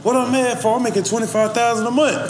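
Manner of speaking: lightly voiced brag of a New Orleans youngster; imitating his young artist. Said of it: "that's how he talking".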